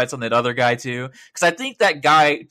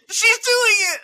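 high, loud squealing "She's doing it!"; ecstatic shriek; 5 dB louder, slower than usual. Retold or imitated. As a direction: scream it in disbelieving delight. Retold excitement, shrieking.

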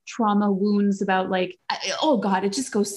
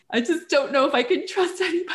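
high, laughing wail about not trusting anyone; self-mocking laughing lament; laughing mock despair; 7 semitones higher than usual. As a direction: play the fragile self through a laugh. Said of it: "And I'm like".

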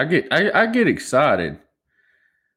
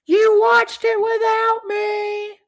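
mock angry wife; 4 dB louder than usual. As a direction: ham up his wife's outrage in a high falsetto. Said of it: "She'd get so".

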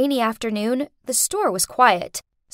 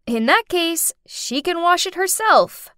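higher-pitched, sassy comeback; eye-rolling retort; 6 semitones higher than usual. A narrator's reading. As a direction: toss it back with teenage attitude. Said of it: "I rolled my eyes and said".

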